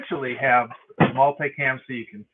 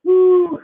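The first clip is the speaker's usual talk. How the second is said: strained ooh; 10 dB louder than usual. Real, not acted. Straining during push-ups.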